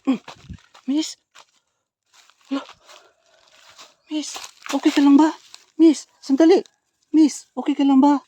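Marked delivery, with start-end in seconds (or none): 4.1-8.2 loud, high urgent calls to a stranger; calling out to a collapsed woman in the game, loud and urgent; calling out loudly. Acted. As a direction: shout to get her attention, worried.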